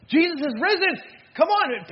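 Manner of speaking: loud, high, joyful proclamation; excited announcement. Acted. Mary telling the disciples Jesus is risen, excitedly. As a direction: burst in with urgent good news.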